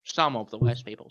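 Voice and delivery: talking through a mouthful of popcorn; eating popcorn while talking; munching popcorn. Real, not acted. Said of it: "Sorry, I'm eating popcorn".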